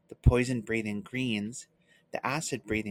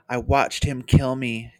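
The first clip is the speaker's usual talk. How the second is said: cried wretchedly; 7 dB louder, slower than usual. A narrator's reading. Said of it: "Caramon cried wretchedly".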